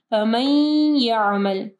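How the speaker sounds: फमन यामल as "famayy-ya'mal", long nasal y; slow फमन यामल, no separate n; merged with ghunnah. Shown as a demonstration. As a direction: melt the n of फमन यामल into a hummed y. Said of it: "मीम को जो है या के साथ मिलाएंगे".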